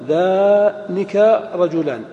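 ذانك said slowly and clearly as ḏānika, with kāf only; correct dual form. Shown as a demonstration. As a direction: spell out ذانك رجلان deliberately, no lām. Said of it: "فتقول عن المثنى". (نطق ذانك ببطء ووضوح)

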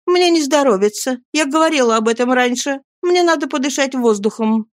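high-pitched pleading female character; young woman Nancy, insistent. A narrator's reading.

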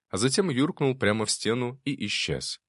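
ordinary delivery

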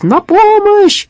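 high, loud, drawn-out scream; cry for help. A narrator's reading. Robber calling out from the hideout, panicked cry. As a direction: shriek it slowly for rescue. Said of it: "Раздался крик".

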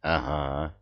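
low croaked yes; hoarse wheeze. Acted. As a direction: squeeze it out hoarsely. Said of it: "просипел начинающий маг".

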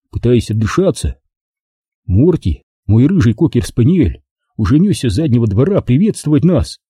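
usual speaking voice

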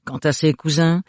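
plain reading voice

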